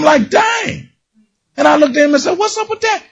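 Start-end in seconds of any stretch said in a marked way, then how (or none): 0.3-0.9 high, stunned "Dang"; amazed. Retold or imitated. Preacher amazed as water pours from the rock. 2.4-3.0 amazed question. Retold or imitated. Asking Moses about the rock.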